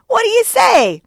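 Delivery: very high, loud demand; soaring, insistent cry; climactic shout. A narrator's reading. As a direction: yell for an answer at fever pitch. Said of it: "cried Stubb".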